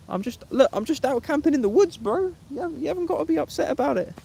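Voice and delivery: high-pitched mock-indignant pleading; mock protest. Streamer answering a tree-hugger jibe, mock protest.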